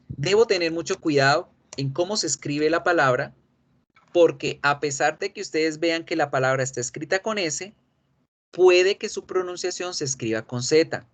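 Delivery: usual speaking voice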